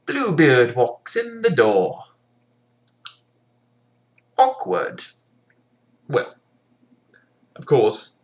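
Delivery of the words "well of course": ordinary talk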